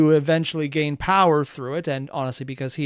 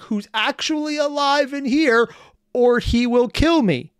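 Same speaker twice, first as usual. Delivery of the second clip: high, loud indignant rant; exasperated disbelief; 8 semitones higher, 4 dB louder, slower than usual. Real, not acted. Commentator rebutting the villain's claim, exasperated. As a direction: protest it slowly, voice pitched way up.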